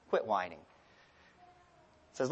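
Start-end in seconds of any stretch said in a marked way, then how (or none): 0.1-0.6 low, softened voice of God; still small voice. Retold or imitated. God answering Elijah in a still small voice, low and soft. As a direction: drop low and quiet for the reply. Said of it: "in that still small voice".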